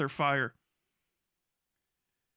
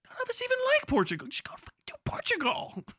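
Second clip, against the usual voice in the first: scoffing Catalan; faster than usual. Retold or imitated. Catalans dismissing Portugal.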